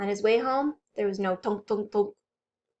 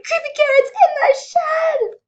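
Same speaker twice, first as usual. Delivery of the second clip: scared scream; 10 dB louder than usual. Acted. Bunny screaming for his mom in fright. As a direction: gasp then high loud screams for Mom. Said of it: "Jasper screamed".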